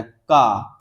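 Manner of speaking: का with the air held, then released; stop then release. Shown as a demonstration. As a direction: block the K, then let the vowel out. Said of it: "अचानक क्या हवा निकलता है".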